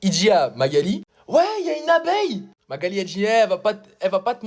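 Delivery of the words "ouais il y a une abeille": high, silly mock voice; mocking classmate. Classmate telling the supervisor about a bee, high squeaky mimic. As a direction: squeak it like a scared kid.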